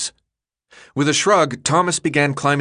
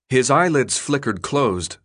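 ordinary delivery

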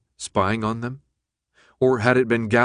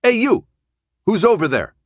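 high, loud calling out; yelled; 11 semitones higher, 6 dB louder than usual. Acted. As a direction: shout across the empty street. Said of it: "Minho yelled again".

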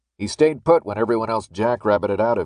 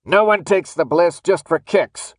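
high, loud shouted accusation; accusing yell; 7 semitones higher, 6 dB louder than usual. A narrator's reading. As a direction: yell it at him from the side. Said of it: "Redshirt yelled from the side".

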